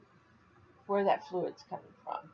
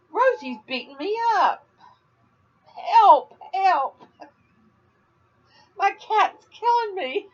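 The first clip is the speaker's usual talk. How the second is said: high, loud, laughing mock distress; mock cry for help; 10 semitones higher, 8 dB louder, slower than usual. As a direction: play the victim of a cat. Mock cry for help about cat.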